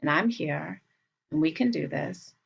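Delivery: soft, calm reassurance; reassuring. Acted. Adult to a worried child, softly reassuring.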